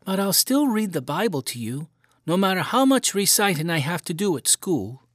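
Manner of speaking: a hint of a five-year-old owning up; little boy confessing to his mother; young boy. A narrator's reading. Boy voiced in story.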